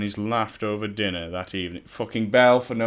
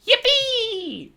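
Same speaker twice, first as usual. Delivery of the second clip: yippee; 6 dB louder than usual. Acted. Apple Bloom cheers with joy.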